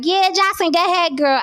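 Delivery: high, sing-song hype for a friend; mock cheerleading. Mocking fans who would cheer for Jocelyn, high and sing-song. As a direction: cheer her on in mock support.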